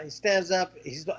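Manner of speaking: ordinary talk, nothing marked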